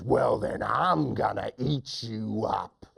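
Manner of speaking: lightly voiced slow lion. Lion threatening the mouse, slow drawn-out menace. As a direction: draw out the lion's threat. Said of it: "the lion says".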